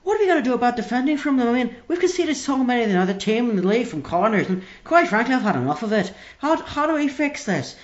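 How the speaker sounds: broad Derry fan fed up with the defending; Northern Irish caller losing patience; Northern Irish accent. Acted. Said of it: "the accent threw me slightly".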